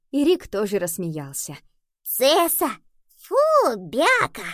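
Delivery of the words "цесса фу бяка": high, loud kitten voice, mocking; high baby-voiced exclamation of disgust; disgusted kitten muttering. A narrator's reading. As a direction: spit it out as a little kitten's "yuck". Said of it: "пробормотал он".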